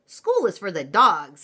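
loud, emphatic storybook mama. Acted. Mama cat telling kitten about school, emphatic. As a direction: punch it out firmly and loudly. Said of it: "stated J-, Mama Junebug Jones emphatically".

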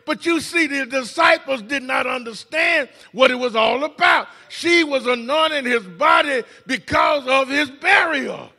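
ringing sermon voice; full-voiced preacher; preaching. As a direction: preach it out to the congregation.

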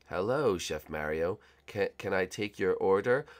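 low villain voice. Acted.

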